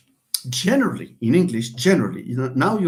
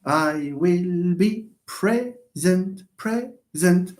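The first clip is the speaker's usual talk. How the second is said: slow, split PRE-SENT into separate beats; drawn-out syllable-counting delivery; 3 semitones higher, much slower than usual. Shown as a demonstration. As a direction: sound out each syllable of "present" slowly, pausing between. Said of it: "how to recognize syllables".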